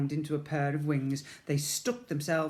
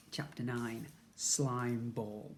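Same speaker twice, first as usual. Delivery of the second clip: usual speaking voice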